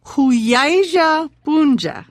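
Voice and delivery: slow, careful teacher echo; slow repetition. Shown as a demonstration. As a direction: echo the phrase slowly for learners. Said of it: "we would say?".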